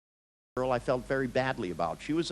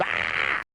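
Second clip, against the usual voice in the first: loud, sustained "aah" yell; retold scream; 7 semitones lower, 5 dB louder than usual. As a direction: act out screaming at someone. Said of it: "and I screamed".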